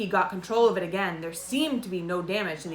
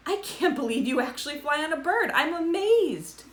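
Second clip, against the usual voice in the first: amazed; 8 semitones higher than usual. A narrator's reading. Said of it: "Cousin Dinky was laughing".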